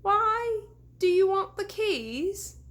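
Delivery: high, slow timid question; nervous question. A narrator's reading. Deputy nervously questioning the ghost, high timid voice. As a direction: ask meekly through fear.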